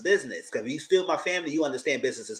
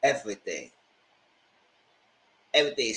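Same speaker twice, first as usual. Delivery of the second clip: mopey; 6 semitones lower, 4 dB quieter than usual. Retold or imitated. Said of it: "She says".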